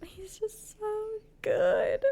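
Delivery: high, slow, swooning sigh of love; drawn-out lovestruck gush; lovesick swoon. Real, not acted. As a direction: melt over him. Swooning over fictional man.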